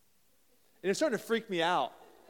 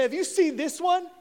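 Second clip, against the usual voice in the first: higher and louder through a laugh; laughing excitedly; 8 semitones higher, 9 dB louder than usual. Retold or imitated. Josiah shows his friend a verse, laughing and excited. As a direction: giggle as you show it off.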